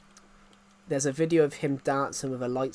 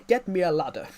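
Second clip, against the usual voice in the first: higher, louder posh English shout; posh Boris Johnson accent; 5 semitones higher, 6 dB louder than usual. Retold or imitated. Streamer mimics Boris stuck on a zip wire, calling out in a posh English accent. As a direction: bark it out like a stranded toff. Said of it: "in his really posh, thick accent".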